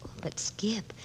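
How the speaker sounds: soft, whispered hesitation; breathy hush. Acted. Judith hushed and shy at Skip's ring. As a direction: breathe it shyly.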